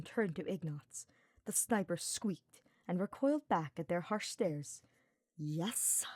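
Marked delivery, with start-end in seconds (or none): 5.4-6.0 a soft, tight, impatient "Yes?"; gritted out. Acted. Hubert tensely answering Ignatz through gritted teeth, soft and clipped. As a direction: bite it off through your teeth. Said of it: "Hubert gritted".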